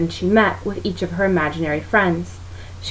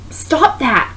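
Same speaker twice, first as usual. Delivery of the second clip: snapped; 7 semitones higher than usual. A narrator's reading. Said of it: "Christine snapped".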